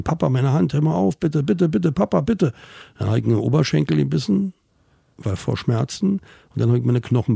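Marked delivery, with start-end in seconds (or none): 0.0-2.5 pleading cry, rising higher with each bitte; child begging in pain. Retold or imitated. Boy begs his father to let go, rising plea. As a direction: beg father to stop, voice climbing. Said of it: "Und ich hab geschrien".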